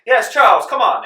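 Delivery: high, loud calling for her husband; yelling to the other room. Acted. The wife calls her husband from another room, high and loud. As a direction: call Charles through the house like a grand lady.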